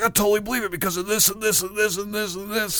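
hammed-up true believer rattling on; mock-crazed rambling; acting crazy. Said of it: "I'm just acting crazy".

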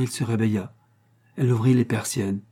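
ordinary delivery

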